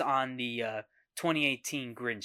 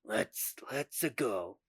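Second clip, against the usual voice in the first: soft little Mario catchphrase; a muted Mario impression; 3 semitones lower, 6 dB quieter, slower than usual. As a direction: quietly imitate Mario's line. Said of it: "with two voices saying".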